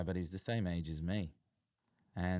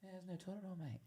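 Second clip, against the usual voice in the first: teasing call; 11 semitones higher, 11 dB quieter, faster than usual. Retold or imitated. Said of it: "yelled out for toilet roll".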